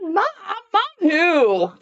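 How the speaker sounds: high-pitched mock-innocent denial; exaggerated, high playing dumb; feigned ignorance. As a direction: act as if never heard of her. Feigning ignorance when asked about Mom.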